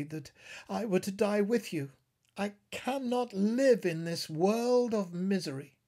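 anguished cry. A narrator's reading. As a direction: wail your wish to die with her. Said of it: "cried she".

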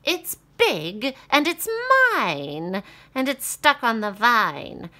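high, loud cackling witch. Acted. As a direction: screech it like a witch. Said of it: "said the witch".

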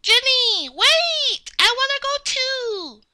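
high, drawn-out plea to wait; high childlike wail; calling out to wait. Pretend. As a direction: call after them like a left-behind little kid. Calling after departing Jenny.

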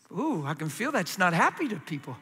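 normal talking voice

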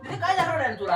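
ordinary talk, nothing marked

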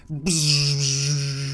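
long buzzing sound effect; vocal battle zap, drawn out; imitated buzz.

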